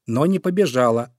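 ordinary delivery, nothing marked

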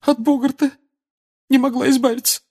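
high tearful voice; Molly confessing to Harry. A narrator's reading. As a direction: speak through tears. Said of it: "дрожащим голосом".

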